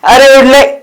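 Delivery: very loud, high cheer; exuberant whoop. Acted. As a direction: shout it as if flying down the road. Whoops after the riding song. (जोश में चिल्लाकर)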